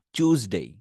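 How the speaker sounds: টিউসডে said "chooz-day". Shown as a demonstration. Teacher joining চুজ into টিউসডে. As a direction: blend চুজ straight into ডে. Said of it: "চুজ".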